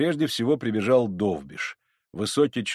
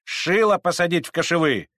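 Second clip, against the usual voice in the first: high, loud crowd yells; raised, scolding cries; abusive shouting; 8 semitones higher, 4 dB louder than usual. Acted. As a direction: shout and curse like a rowdy mob. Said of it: "кричала с бранью толпа".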